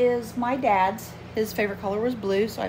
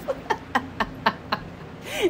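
laugh; 13 semitones higher than usual. Real, not acted. Laughs at her own whispering.

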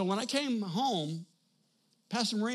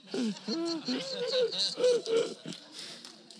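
high squealing laugh. Real, not acted. Overcome by the memory.

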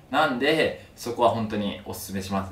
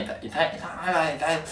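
put-on high, slow whining old man; old man in pain; 7 semitones higher than usual. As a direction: whimper like an old patient in pain. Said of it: "おじいちゃん痛い".

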